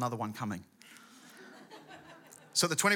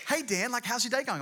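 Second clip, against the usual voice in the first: high-pitched chirpy wife; mock wifely check-in, pitched way up; imitating his wife; 8 semitones higher than usual. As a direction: put on a wife's eager morning greeting. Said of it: "she's like".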